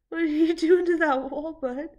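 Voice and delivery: calling out. Real, not acted. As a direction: call out to the cat, amused. Talking to pet on screen.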